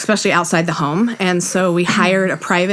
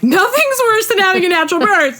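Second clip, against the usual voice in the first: pitched way up, disbelieving and mock outraged; high incredulous retort; 11 semitones higher than usual. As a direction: throw it back high, half laughing at the idea. Her retort to the doctor.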